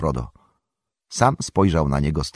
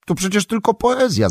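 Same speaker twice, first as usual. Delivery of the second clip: much higher, light dismissive exclaim; Pippin exclaiming; 14 semitones higher, 3 dB louder than usual. A narrator's reading. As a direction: toss it off as mere poetry. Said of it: "zawołał Pippin".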